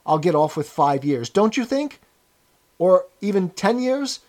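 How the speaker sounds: anxious appeal, tags rising for agreement; eagerly appealing. A narrator's reading. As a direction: plead for reassurance from an old chap. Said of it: "began eagerly appealing to him".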